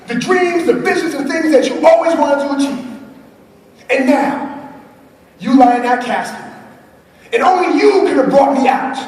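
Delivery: mentor's words passed to the crowd, in impassioned preaching; quoting Les Brown. Retold or imitated. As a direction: deliver it like a solemn deathbed warning. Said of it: "Mister Les Brown says".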